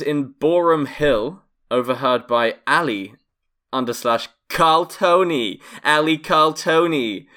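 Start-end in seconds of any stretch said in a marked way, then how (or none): 3.7-7.3 put-on slow, drawn-out New York accent, a little higher; mock New York accent calling out a name. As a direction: read the name like a New Yorker. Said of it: "the New York accent".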